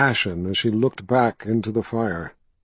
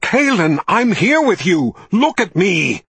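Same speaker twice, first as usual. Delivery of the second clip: much higher, louder call of her name; shouted name; 5 semitones higher, 5 dB louder than usual. A narrator's reading. Richard calling to Kaelin, desperate shout. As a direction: yell her name to pull her back.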